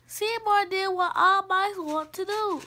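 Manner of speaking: plain reading voice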